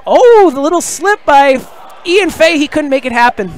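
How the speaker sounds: excited, high-pitched reaction call; live play-by-play. Real, not acted. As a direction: jump up in pitch at a near miss. Reacts live to a near basket.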